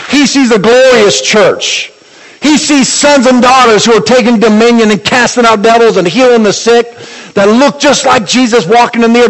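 high, sustained preacher's proclamation; fervent, ringing sermon climax; impassioned preaching. Real, not acted. Preaches at rising full force.

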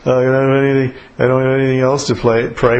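half-mumbled, monotone fizzle; mock running dry. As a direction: imitate a prayer petering out. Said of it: "And you run out of gas".

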